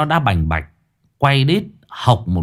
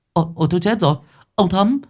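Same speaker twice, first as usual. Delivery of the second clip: panicked cry; 12 semitones higher, faster than usual. Acted. Bystander begging neighbours to carry Thấm inside, panicked. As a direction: call the neighbours for help in a panic.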